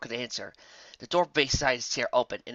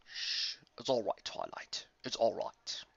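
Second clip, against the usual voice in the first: soothing hush; 8 dB quieter, slower than usual. Acted. Starswirl soothing Twilight.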